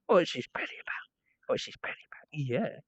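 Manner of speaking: whispery dog-fussing voice; hushed pet talk, barely voiced; cooing to dog. Real, not acted. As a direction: coo it in a near-whisper. Cooing to the dog during belly rub.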